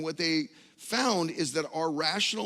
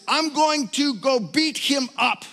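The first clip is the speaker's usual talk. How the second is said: louder and higher, slow hammed-up fury; mock angry outburst; 11 semitones higher, 11 dB louder, slower than usual. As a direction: bellow it like a furious little brother. Retold angry thought about brother.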